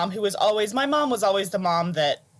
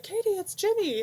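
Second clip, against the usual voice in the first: imitating Galway; 14 semitones higher than usual. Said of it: "He's like".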